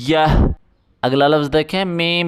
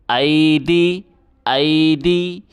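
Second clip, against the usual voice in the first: usual speaking voice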